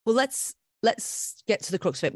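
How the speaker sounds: ordinary talk, nothing marked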